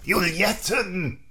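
put-on slow, drawn-out offstage call from a smoky old nurse; old smoker nurse calling. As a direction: call her name long and slow like a raspy old nurse. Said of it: "hat viel geraucht, deswegen klingt die so".

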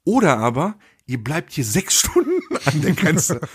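retold threat breaking into laughter; laughing mid-quote. Real, not acted. Retelling a border officer's threat, laughing through it. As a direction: crack up halfway through the warning.

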